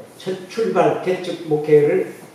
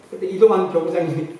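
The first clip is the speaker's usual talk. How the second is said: ordinary talk, nothing marked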